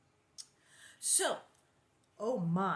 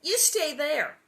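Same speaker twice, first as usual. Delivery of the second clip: command; 7 semitones higher, 8 dB louder, slower than usual. A narrator's reading. Laura commanding Nellie.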